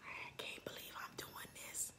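barely audible whisper to oneself; real, voiceless murmur of disbelief; whispered aside. Real, not acted. Overwhelmed aside to self during ritual.

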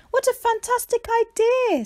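thrilled friend cheering; excited cheer. A narrator's reading. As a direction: burst with joy. Zora cheering.

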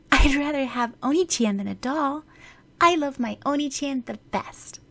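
put-on high, affectionate little girl; a small sister telling her brother he matters most. Erika's sweet declaration to her brother.

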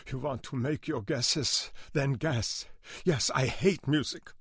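put-on high, accented, bitter outburst; trembling rage. A narrator's reading. Older man telling why he hates music, trembling rage. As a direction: rage rising in pitch to the end. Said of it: "face twisted in trembling rage".

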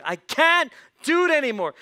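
high, loud exasperated outburst; fed up. Acted. Boy stuck doing his sister's chores, loudly fed up. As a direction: cry out at the end of your patience. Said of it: "He got just fed up".

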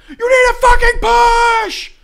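imitating a raging teammate, screaming; mock gamer rage. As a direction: scream orders at the team. Friend raging during capture the flag.

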